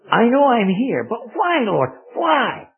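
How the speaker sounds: high, loud, retold plea to the Lord; imploring cry. Puzzled patient asking God why he is there, imploring cry. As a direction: cry out the question, pitch leaping up on each why.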